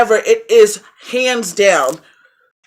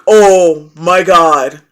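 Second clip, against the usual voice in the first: very slow, louder drawn-out disbelief; stunned; 4 semitones lower, 6 dB louder, much slower than usual. Retold or imitated. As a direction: stretch it out in shock. Stunned after the movie ending.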